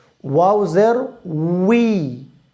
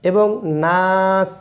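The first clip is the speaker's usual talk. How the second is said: normal talking voice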